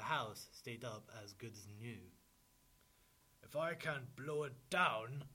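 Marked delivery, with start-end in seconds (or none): 3.4-5.3 loud, higher-pitched wolf threat; frustrated wolf. A narrator's reading. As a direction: rant loudly as the thwarted wolf. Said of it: "Wolf said".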